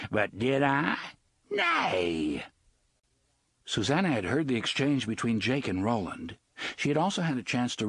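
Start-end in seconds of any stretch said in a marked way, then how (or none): 0.1-2.4 lightly voiced sly pirate; a hint of mocking pirate; Gasher the pirate. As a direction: tease it like a gloating rogue. Gasher teasing.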